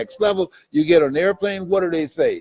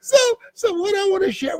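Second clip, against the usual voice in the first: laughing. Real, not acted. Laughing before resuming speech.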